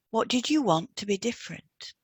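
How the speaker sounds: normal talking voice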